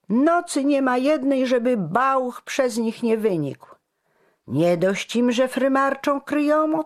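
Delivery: high, loud outraged grumbling; indignant railing. A narrator's reading. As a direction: rail against the nobles. Indignant townsmen rail against nobility.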